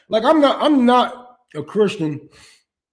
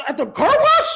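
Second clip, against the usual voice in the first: disbelieving; 3 dB louder than usual. Real, not acted. Pictures Jesus at the car wash.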